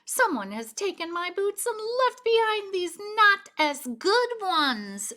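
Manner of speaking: high, loud wailing little bear; wailing Baby Bear. A narrator's reading. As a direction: wail it out, heartbroken cub. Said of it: "she wailed".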